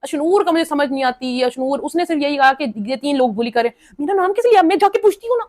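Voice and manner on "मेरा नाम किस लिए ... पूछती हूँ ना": much higher, hammed-up indignant challenge; mock angry confrontation. Ashnoor challenging the kitchen group, in a much higher mock-indignant voice. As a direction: demand who took her name, overplayed.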